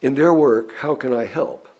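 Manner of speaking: normal talking voice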